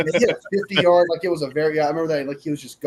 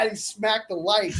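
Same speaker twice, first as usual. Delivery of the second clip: ordinary talk, nothing marked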